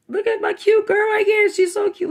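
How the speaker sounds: high, bright gushing over a cute picture; gushing over a drawing. Real, not acted. Vlogger gushing to viewers over a drawn girl, high and excited. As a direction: squeal it like you just saw the cutest thing.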